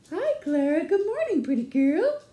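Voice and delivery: high, loud doggy-talk greeting; sing-song voice for a pet; cooing pet greeting. Real, not acted. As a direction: coo a bright hello to the dog. Greeting the pet dog Clara.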